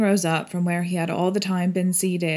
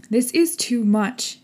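higher-pitched indignant protest; angry; 5 semitones higher, 4 dB louder than usual. A narrator's reading. Arthur objects to opening the coffin, angry. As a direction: protest with mild anger. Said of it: "angrily rising again".

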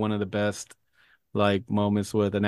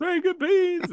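high squeaky quote; slower than usual. Retold or imitated. Echoing Warren's catchphrase.

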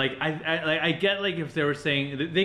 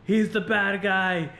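higher-pitched imitated yelling; mock yell; 6 semitones higher, 3 dB louder, slower than usual. Woman warning the cops about the bad guy, mock yell. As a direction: call it out like a half-yelled warning. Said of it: "yelling, like".

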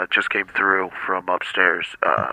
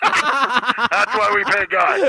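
laughing, drawn out; 3 dB louder, much slower than usual. Real, not acted. Laughing at the joke.

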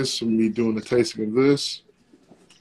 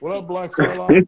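imitating a greeting; 6 semitones higher, 10 dB louder than usual. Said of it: "he said".

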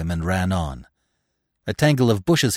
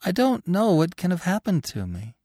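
high voice, puzzled but untroubled; almost happy; 9 semitones higher than usual. A narrator's reading. Dying hare's wife explains herself to Bambi, almost happy. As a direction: make light of it in a high voice. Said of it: "almost as if she were happy".

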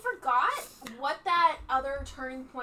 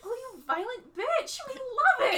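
laughing adoration; 11 semitones higher, 4 dB louder, slower than usual. Retold or imitated. Voicing adoring husband.

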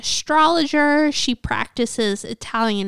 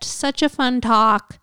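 ordinary talk, nothing marked

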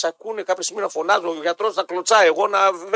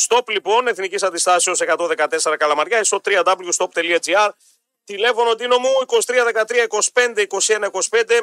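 lower-pitched, steady promo delivery; radio ad read; 3 semitones lower than usual. Acted. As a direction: sell it like a live radio sponsor read. Host reads casino and shop ads.

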